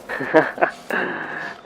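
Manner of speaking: quiet, breathy little laugh; soft chuckle. Real, not acted. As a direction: chuckle softly to yourself. Amused by creature's useless wings.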